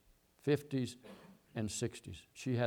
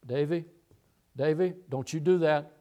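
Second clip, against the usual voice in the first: stern mother; 3 semitones higher, 5 dB louder than usual. Retold or imitated. Mother scolding her son.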